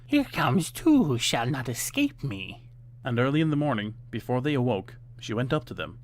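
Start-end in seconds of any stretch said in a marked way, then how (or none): 0.0-2.7 slow, gloating witch voice; slow wicked witch gloat. A narrator's reading. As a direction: savour the threat. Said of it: "she laughed wickedly".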